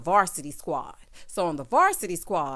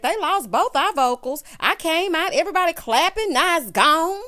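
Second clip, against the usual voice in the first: mock whine; 7 semitones higher, 5 dB louder than usual. Imitating upset Irish.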